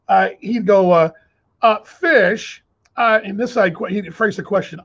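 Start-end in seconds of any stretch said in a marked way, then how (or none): 1.8-2.6 imitating the interviewer. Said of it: "and I'll do the imitation".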